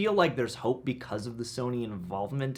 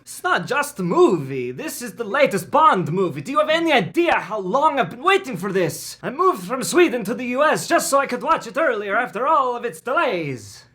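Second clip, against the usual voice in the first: put-on Swedish accent, a bit raised; 11 semitones higher, 7 dB louder than usual. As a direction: Swedish accent, pleading for the Bond film. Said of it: "Do your best Swedish accent".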